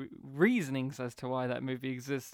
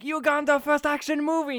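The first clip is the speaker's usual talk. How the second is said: high, loud trailer yell; trailer announcer shout; 12 semitones higher, 8 dB louder than usual. Retold or imitated. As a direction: belt it like a hyped trailer. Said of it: "the trailer's what we get from the movie".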